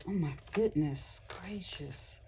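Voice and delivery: soft, slow whisper of wonder; awed whisper. Real, not acted. Crafter to viewers, overwhelmed by the paper, whispered. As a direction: breathe it out in awe.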